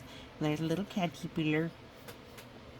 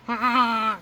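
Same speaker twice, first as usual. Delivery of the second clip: loud playful dino roar, pitched up; mock dinosaur roar; 5 semitones higher, 8 dB louder than usual. Goose the dinosaur roars at friends, loud mock roar. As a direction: roar like a kid playing T-Rex.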